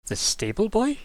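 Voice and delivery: high, surprised question; baffled question. Acted. Old woman answers the adventurer, high and baffled. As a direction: pitch it up in disbelief.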